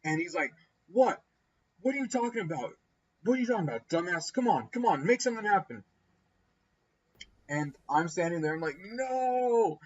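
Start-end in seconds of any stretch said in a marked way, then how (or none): 0.9-5.8 higher-pitched mock defiance; retold confronting back. Tipsy friend confronting the brother back, mock combative. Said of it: "confronting the guy back". 8.8-9.8 high, drawn-out mock cry of no; retold dismay. Bystander standing there as two guys argue, mock dismay. Watching the fight brew, helpless.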